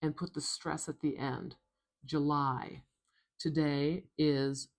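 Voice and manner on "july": "July" as "juh-LIE", stressed at the end; tiny first vowel in "July", strong second; weak-strong "July" with a schwa. Shown as a demonstration. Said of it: "use that schwa".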